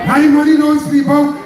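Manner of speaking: hammed-up arrogant politician; mocking Keith Rowley. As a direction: sneer his words back at the crowd. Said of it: "In the most arrogant, disrespectful style".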